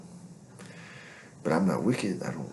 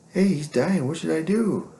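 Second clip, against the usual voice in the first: higher and louder, faked alarm; mock worried caller; 11 semitones higher, 5 dB louder than usual. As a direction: voice her call in mock panic. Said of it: "It's like".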